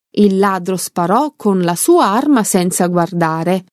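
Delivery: plain reading voice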